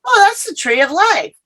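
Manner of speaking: high, wondering gasp of recognition; awed recognition. Retold or imitated. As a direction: marvel at it like a child seeing it first. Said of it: "and I went".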